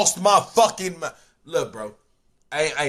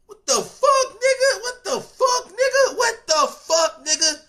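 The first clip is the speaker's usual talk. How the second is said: high, cracking laugh-cry of disbelief. Retold or imitated. Convict reading the ruling, laugh-crying in disbelief. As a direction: squeal it between laughing and crying. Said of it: "I know he was like".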